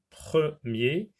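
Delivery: "premier" in two beats, pre-mier; "premier" slowly, syllable by syllable; split into syllables. Shown as a demonstration. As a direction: sound out "premier" with a gap before mier. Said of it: "comme dans le mot premier".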